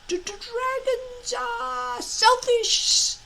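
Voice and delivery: high, slow, stuttering dragon voice; a small dragon arguing with itself, tripping on the words; squeaky stutter, talking himself into it; stammering little dragon. A narrator's reading. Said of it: "argued Toothless to himself".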